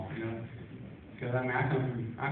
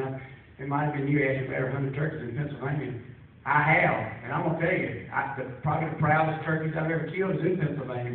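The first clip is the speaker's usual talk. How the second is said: normal talking voice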